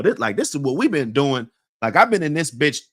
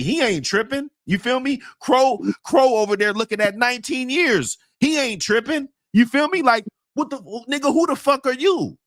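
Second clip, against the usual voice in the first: much higher, worked-up ranting; heated rant; 11 semitones higher than usual. Real, not acted. Venting to a friend about the newcomer, heated and high. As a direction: get fired up, the words spilling out.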